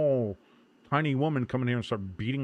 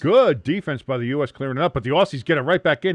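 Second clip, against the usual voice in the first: punchy, loud soccer commentary; match commentator building tension; live play-by-play; 2 semitones higher, 6 dB louder than usual. Real, not acted. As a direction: call the defense and attack as it happens.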